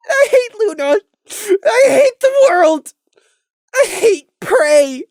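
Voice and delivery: high, loud and slow, sobbing hatred; crying outburst. Acted. Crimson cursing Luna and Prey, high sobbing shout. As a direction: rage through the tears. Crimson rages through tears.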